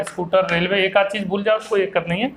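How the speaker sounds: usual speaking voice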